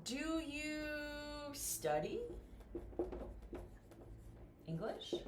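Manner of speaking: "English" lifted at the end of the question; rising question intonation. Shown as a demonstration. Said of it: "Yes and no questions go up".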